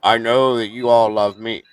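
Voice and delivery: low, slow, lifeless monotone drone; monotone impression. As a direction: drone it out like a boring streamer with no personality. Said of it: "that's how he sounds".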